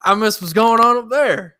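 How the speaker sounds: high-pitched put-on Southern accent; hammed-up country-bumpkin accent; mock country accent. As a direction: play a complaining simpleton with a country accent. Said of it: "that's almost a good accent".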